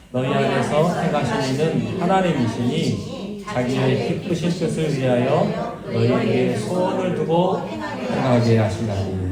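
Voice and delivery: slow unison reading of the verse; scripture reading. Real, not acted. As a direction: read the verse together, slowly and solemnly. Congregation reads scripture aloud together.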